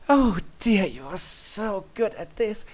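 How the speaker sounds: high, slow, delighted Luna voice; pleased princess. A narrator's reading. Luna praising your wing rub, high and slow.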